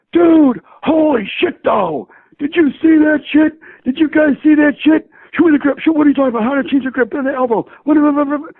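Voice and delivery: very high, loud, amazed yelling; excited shout. Retold or imitated. Director amazed by the shooting, high excited yelling. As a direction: squeal it like someone blown away by what he saw. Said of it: "He's like".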